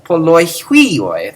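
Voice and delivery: poloihuioi slow, run together, accent on the end; slow teacher's poloihuioi; model pronunciation. Shown as a demonstration. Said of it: "that's how you should say it".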